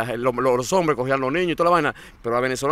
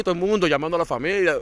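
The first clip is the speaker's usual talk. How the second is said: normal talking voice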